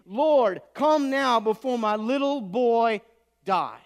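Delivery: loud, slow, urgent plea; pleading. Acted. Royal official pleading with Jesus for his dying boy, loud slow plea. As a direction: beg as if time is running out. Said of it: "The official pleaded".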